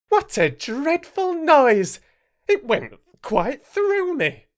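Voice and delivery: high, slow, put-upon servant's voice; a maid rattled by the racket; exclaiming Abbott. Acted. Abbott the maid complains about the child's scream, high and slow. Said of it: "exclaimed Abbott".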